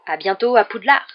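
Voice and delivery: loud, cheery send-off; called farewell. A narrator's reading. Hagrid leaves for Hogwarts, calling goodbye. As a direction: call it out while heading away.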